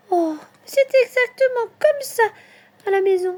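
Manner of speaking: very high, squealed little delight; delighted baby voice. A narrator's reading. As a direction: rejoice as a baby animal, voice way up. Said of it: "se réjouit bébé Martre". (toute petite voix aiguë et ravie)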